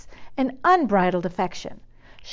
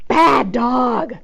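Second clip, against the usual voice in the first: scolding; 3 semitones higher, 6 dB louder than usual. Acted. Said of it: "scolded Eugenia".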